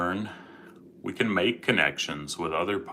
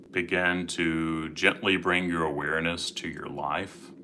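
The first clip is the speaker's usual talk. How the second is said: guided meditation; 7 dB quieter than usual. Acted. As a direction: gently guide listeners into calm.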